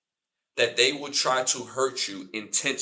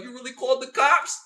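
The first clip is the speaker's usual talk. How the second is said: high mock woman's voice; 11 semitones higher, 4 dB louder than usual. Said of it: "she was like".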